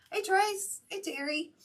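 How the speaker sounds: high-pitched call to the dogs. Retold or imitated. Calling the loose dogs.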